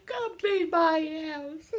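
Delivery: slow, loud, high laughing whine; playful laughing plea to viewers. Pretend. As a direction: beg through laughter.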